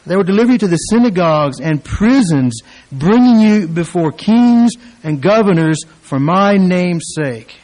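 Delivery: loud declaimed scripture reading; preaching at full force. Acted. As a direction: proclaim the verse to the congregation.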